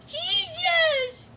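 very high squeaky exclamation; Pikachu. Acted. Sidekick reacting to the pile of money, astonished squeak. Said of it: "Sure, Pikachu".

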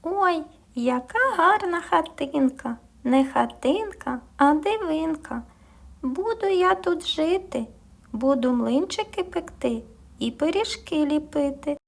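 delighted little mouse finding a house. Acted. (мишка-шкряботушка тішиться хатинкою, тонким голоском)

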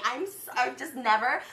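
ordinary talk

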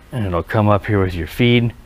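ordinary talk, nothing marked

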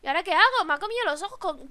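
ordinary delivery

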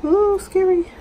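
high, loud squealed scary; scared squeal. Real, not acted. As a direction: squeal it out in fright.

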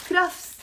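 "crafts" drawn out with a rolled r, krafs; Lebanese pronunciation. Shown as a demonstration. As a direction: model "crafts" slowly in a Lebanese accent. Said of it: "we pronounce it crafts".

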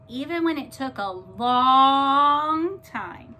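slow and loud, stretched; drawn-out slow. A narrator's reading. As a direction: stretch it out to show the long wait. Waiting a long time.

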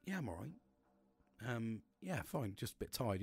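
soft, subdued brush-off; pretending okay. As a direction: quietly cover up that you've been crying. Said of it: "to sound like I was okay".

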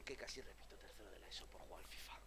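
whispered confession; whispering. Real, not acted. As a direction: drop to a hushed whisper. Confessing quietly about failing school.

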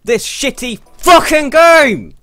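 slow, loud words rising to a high yell; furious rant building to screaming. Real, not acted. Gamer raging at the game, escalating shout.

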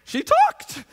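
high, loud burst of amazement; stunned disbelief. Retold or imitated. Whole class reacting to the silent girl speaking, stunned. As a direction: exclaim it like a dumbfounded crowd.

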